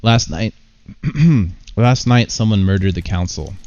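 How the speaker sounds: ordinary talk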